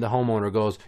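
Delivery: normal talking voice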